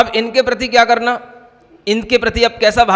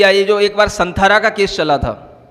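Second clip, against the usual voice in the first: normal talking voice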